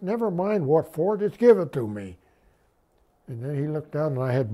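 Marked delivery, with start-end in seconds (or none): 0.0-2.2 louder, higher, brushing off the question; retold impatient demand, pushed louder; impatient retort. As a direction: cut the question short, insistently. Impatient retort in retold battlefield exchange.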